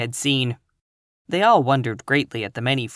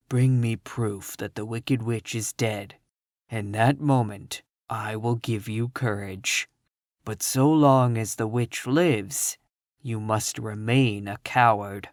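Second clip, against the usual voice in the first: low Oz voice; 3 semitones lower than usual. A narrator's reading. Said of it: "and the voice said".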